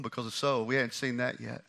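normal talking voice

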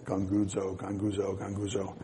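low, soft grumbling mutter under the breath; a low, murmured complaint, repeated. Retold or imitated. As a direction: mutter it like discontented workers. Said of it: "It sounds like grumbling".

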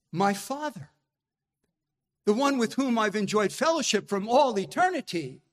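ordinary delivery

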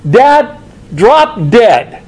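very slow, each word dropped; very slow, deadpan dad, drop dead; blunt insult. Retold or imitated. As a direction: spell it out coldly, word by word. Son's meaning in modern terms.

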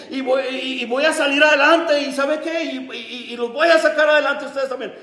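high, impassioned preacher's voice; urgent pulpit recollection; fervent testimony. Acted. Preaching at full force.

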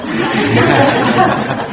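bursting out laughing, loud and high; a sudden amused laugh; real laugh. Real, not acted. Wife laughing at her memory of meeting John, real laughter.

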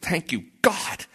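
high, drawn-out imitated prayer; retold crying out to God. New convert thanking God for mercy, crying out. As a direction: stretch out an acted grateful cry. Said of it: "he's crying out to God".

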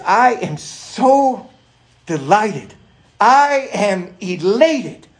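very slow, loud, drawn-out elation; excited delight. Acted. Preacher voicing a father proud of his children, elated. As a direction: savor every word with emphatic joy. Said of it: "Look what he's excited about".

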